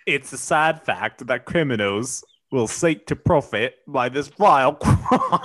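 badly faked British newsreader; British accent. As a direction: read it in a shaky-skilled British accent, cracking up at the end. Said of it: "I'm not good at a British accent".

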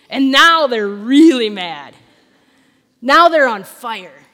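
loud, slow, drawn-out emphasis; emphatic build-up. Real, not acted. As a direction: hammer each phrase loudly and slowly. Preacher dramatises the Pharisees' anger.

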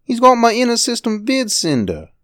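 put-on high boy's voice, hurt and angry; angry upset boy. Boy tells Sally about his toy, high upset voice. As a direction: complain like a wronged little boy. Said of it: "His small face twisted with rage and misery".

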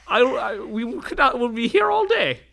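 high tailor voice dissolving in laughter; laughing in character. Acted. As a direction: push through the giggles in character. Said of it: "speaks in a voice like this".

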